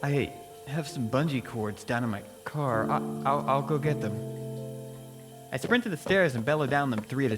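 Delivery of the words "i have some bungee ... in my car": ordinary delivery, nothing marked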